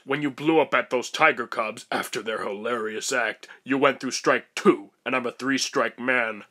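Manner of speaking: ordinary talk, nothing marked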